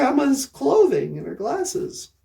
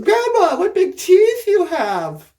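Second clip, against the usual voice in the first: put-on little girl voice; 5 semitones higher, 5 dB louder than usual. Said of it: "She goes".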